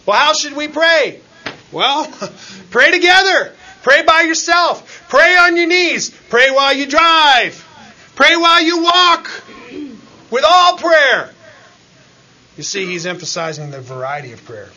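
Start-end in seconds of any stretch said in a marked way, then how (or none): 0.1-11.3 high, loud, fervent preacher's cry; preaching at full force. Acted. Preacher exhorting the congregation how to pray, loud and fervent. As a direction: hammer each command like a sermon climax.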